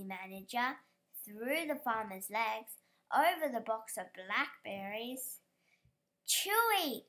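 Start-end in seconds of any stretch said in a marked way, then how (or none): 6.3-7.0 high, loud call. A narrator's reading. Lottie calling her runaway dog, high call. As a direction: call the dog from across the crowd. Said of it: "called Lottie".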